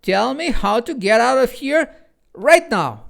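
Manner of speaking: high, loud bossy shout; girl yelling after the two creatures, commanding; nasty commanding yell. A narrator's reading. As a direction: order them back. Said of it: "she yelled in a nasty, commanding tone".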